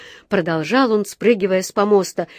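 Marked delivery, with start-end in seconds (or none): none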